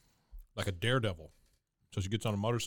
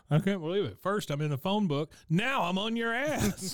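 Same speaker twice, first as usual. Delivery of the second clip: much higher and louder, slowing down; Steve Martin impression; 10 semitones higher, 4 dB louder than usual. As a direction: build to a high, stretched punchline. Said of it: "He goes".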